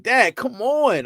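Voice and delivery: high, slow whiny daughter voice; high, slow mock-whine of a daughter; daughter's whine. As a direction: drag it out like an embarrassed kid.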